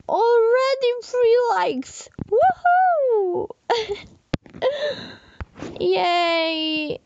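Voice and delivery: high, squealing cheers through tears; joyful happy crying. Real, not acted. Vlogger sees three likes, squealing through happy tears. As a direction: celebrate every like, voice breaking. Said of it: "sorry to hear me crying".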